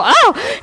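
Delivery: high sharp pained cry; imitated cry of pain. Woman shocked in the experiment, high pained cry. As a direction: yelp like a jolt just hit. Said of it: "she screams".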